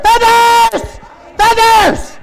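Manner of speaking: very high, loud yell; piercing rallying shout of one word; imitated battle cry. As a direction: scream the word out as a weapon. Re-enacting the woman's battle cry.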